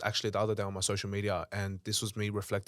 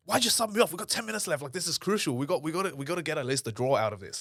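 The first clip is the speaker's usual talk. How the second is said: retold frantic protest; 6 semitones higher than usual. Subbed goalkeeper pleading with his coach, retold frantic indignation. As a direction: protest as if the match depends on it. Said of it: "I've lost emotional control".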